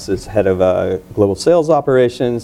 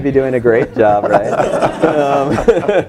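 laughing; 5 semitones higher, much slower than usual. Real, not acted. Echoing the host's compliment, laughing.

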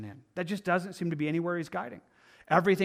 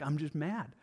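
ordinary talk

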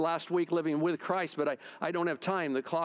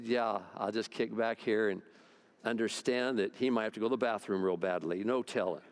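easygoing, lower inner reply; relaxed reply; 7 semitones lower than usual. Retold or imitated. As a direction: shrug it off calmly. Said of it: "I said".

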